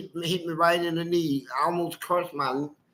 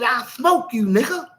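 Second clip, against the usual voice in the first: threatening gunman; 6 semitones higher, 3 dB louder than usual. Retold or imitated. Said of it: "he said".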